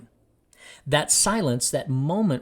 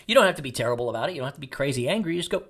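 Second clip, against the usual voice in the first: usual speaking voice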